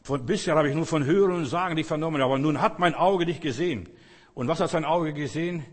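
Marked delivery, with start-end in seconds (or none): none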